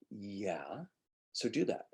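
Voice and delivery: hushed, drawn-out "yeah"; soft voiceless agreement; whispered yeah. Retold or imitated. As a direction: whisper it as a pointed reply. Coach's pointed reply to actor.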